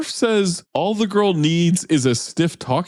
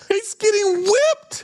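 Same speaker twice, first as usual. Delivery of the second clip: excited; slower than usual. Real, not acted. Incredulous outburst at movie scene.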